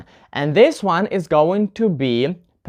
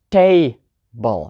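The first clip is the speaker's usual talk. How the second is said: table with TA strong and drawn out, BLE weak; stress on first syllable; 6 semitones lower than usual. Shown as a demonstration. Teacher showing a two-syllable noun, table stressed on TA. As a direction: hit the TA in table. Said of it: "put the stress on the first syllable".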